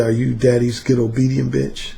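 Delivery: gentle seductive tease; low soft seductive voice; smooth seductive. Shown as a demonstration. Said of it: "There's no harshness in the tone. It's very smooth and seductive".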